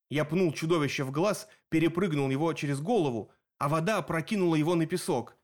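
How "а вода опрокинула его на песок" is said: plain reading voice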